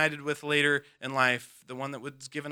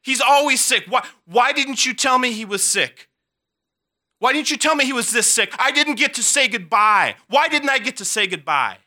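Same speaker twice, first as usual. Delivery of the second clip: much higher, much louder desperate yelling; panicked screaming; 11 semitones higher, 14 dB louder than usual. Retold or imitated. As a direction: scream it out in blind panic and blame. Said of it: "screaming and yelling".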